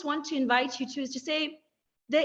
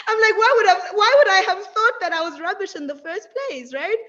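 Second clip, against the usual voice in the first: louder and higher, laughing; 5 semitones higher, 7 dB louder than usual. Real, not acted. Amused at grandfather's message, laughing. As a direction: laugh while asking.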